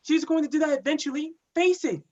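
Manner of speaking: high, forceful comeback; heated retort. Acted. Cop arguing back at accusing partner, heated retort. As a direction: push back hard, pitch jumping up. Partners quarrel in patrol car.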